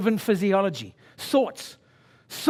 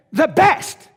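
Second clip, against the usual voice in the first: higher and louder, rallying the crowd; rousing cry; 9 semitones higher, 9 dB louder than usual. Real, not acted. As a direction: shout it like a coach.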